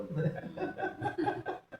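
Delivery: high-pitched burst of laughter; real laugh. Real, not acted. Teacher joking with students about hearing Gabriel, high chuckling laugh. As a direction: laugh at your own joke.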